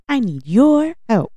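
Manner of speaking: Dora impression. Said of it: "Oh my God".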